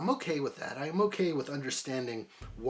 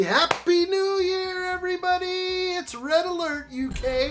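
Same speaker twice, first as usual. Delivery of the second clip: excited shout; 6 dB louder than usual. Real, not acted. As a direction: yell it out to the whole channel. Midnight countdown celebration shout.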